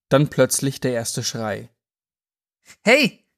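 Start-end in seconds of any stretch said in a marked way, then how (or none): 2.8-3.3 sudden high shout of protest. Acted. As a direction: yell it out, startled and loud. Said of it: "rief ein Mann".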